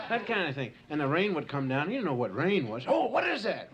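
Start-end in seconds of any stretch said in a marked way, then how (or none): 2.9-3.7 startled caveman. Acted. Caveman frightened by rain.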